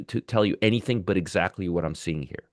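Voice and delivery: ordinary delivery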